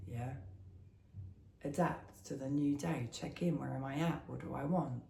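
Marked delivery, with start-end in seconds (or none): none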